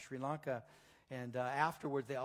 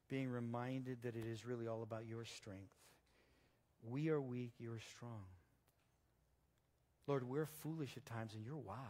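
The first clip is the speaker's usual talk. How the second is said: closing prayer. Acted. Closing prayer led from pulpit.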